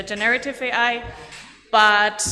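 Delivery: normal talking voice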